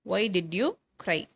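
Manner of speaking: word by word for the class; slow dictation. Shown as a demonstration. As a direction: spell it out slowly. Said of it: "teacher dictates a model sentence". (மெதுவாக சொல்லிக்காட்டுதல்)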